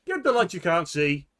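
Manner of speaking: loud, high hawker cry; rival vendor calling. Acted. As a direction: shout to draw the crowd. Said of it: "someone next to him is going".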